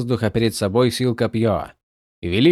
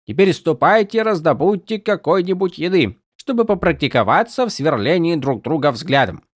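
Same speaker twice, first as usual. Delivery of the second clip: hammed-up barking of orders; mock drill sergeant; 8 semitones higher, 4 dB louder than usual. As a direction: parody a grumpy sergeant. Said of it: "ты говоришь скорее голосом Тефта". (пародия на сурового сержанта)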